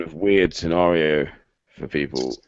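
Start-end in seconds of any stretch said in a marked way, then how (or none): 1.8-2.4 dropping call audio; faint, breaking up on a bad line; cutting out. Real, not acted. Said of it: "I think you're cutting out".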